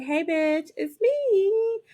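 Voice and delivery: high, sing-song mock greeting; creepy cheery Tara. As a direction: pop up with eerie glee. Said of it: "Tara pops up and is like".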